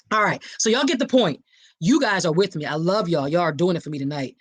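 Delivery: ordinary talk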